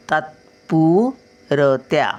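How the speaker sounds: तात्पुरत्या stretched out slowly, half त; slow careful syllables. Shown as a demonstration. Grandmother reading to children, slowly. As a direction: spell out तात्पुरत्या by ear. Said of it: "त थोडासा या".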